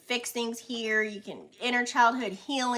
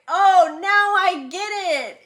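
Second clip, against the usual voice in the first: loud, high dawning surprise; like a light bulb switching on; sudden realization; 8 semitones higher, 13 dB louder, slower than usual. Retold or imitated. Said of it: "you'll be like".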